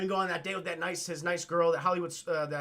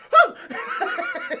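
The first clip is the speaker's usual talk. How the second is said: very high, loud "huh" yelp; comic falsetto gasp of shock; mock startled yelp; 9 dB louder than usual. Will Smith as the saucer hits ground, mock startled yelp.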